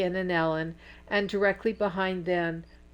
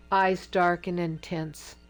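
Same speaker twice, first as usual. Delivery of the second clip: usual speaking voice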